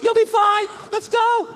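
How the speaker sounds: high, loud trainer shouting encouragement; hyped shout. Retold or imitated. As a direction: yell it like a coach pumping you up. Trainer hyping him up, shouting.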